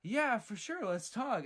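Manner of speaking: overly cheery mock welcome, pitched high; sugary fake-nice invitation; greeting door knockers with put-on enthusiasm; fake friendly. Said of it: "put on this big old fake smile".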